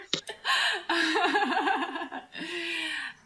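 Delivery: high-pitched burst of laughter; long, breaking up laughing before performing; helpless high laugh; real laughter. Real, not acted. Asked to improvise Gal Gadot accent.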